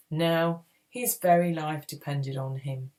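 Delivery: plain reading voice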